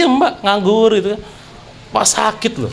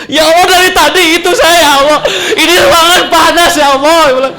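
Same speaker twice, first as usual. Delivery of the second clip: high, loud, half-laughing wail of frustration; a comic cry of despair, high and loud; desperate laughing cry; 8 semitones higher, 5 dB louder than usual. Retold or imitated. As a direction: wail it out between laughs, all pent-up. Helpless in hot ICU, mock desperate.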